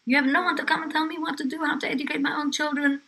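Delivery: high, loud, outraged outburst; retold angry scream. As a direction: throw the intruder out at full pitch. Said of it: "screamed at her".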